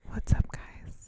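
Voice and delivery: slow soft whisper; breathy ASMR greeting. Acted. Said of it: "Do the A S M R voice".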